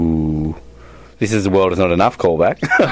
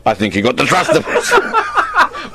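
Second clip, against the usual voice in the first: put-on Italian accent; 3 semitones higher than usual. Movie quote, put-on accent.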